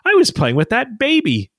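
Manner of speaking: put-on high little kid voice; playful imitation of a small child; voicing his little son up high; toddler voice.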